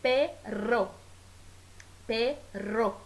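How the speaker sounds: "perro" split as pe... rro; "perro" in two beats, pe, then trilled rro; syllables with rr undivided. Shown as a demonstration. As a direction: pause before a rolled rr in "perro". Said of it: "La R R no se separa".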